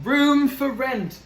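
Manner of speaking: slow sign reading. Acted. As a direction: spell out the sign ponderously. Pig reads the sign.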